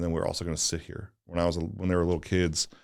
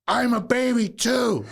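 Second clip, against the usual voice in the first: put-on much higher, slow and pouty, like a hurt small boy; little boy voice; 7 dB louder, slower than usual. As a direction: play the sulky toddler, drawn out and high. Son's hurt reply, retold.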